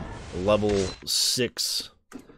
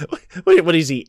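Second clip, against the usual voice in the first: high, loud stammer of confusion; incredulous high-pitched sputter; baffled disbelief; 5 semitones higher, 5 dB louder, faster than usual. Real, not acted. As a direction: squeak it out, laughing and lost. Baffled by the game's story.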